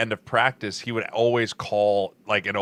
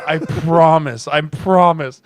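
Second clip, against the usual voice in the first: slow pleading promise; 4 semitones higher, 6 dB louder, much slower than usual. Retold or imitated.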